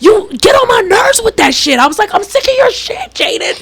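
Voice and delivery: high-pitched exasperated mom yell; fed-up high holler; retold hollering. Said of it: "I was in there hollering".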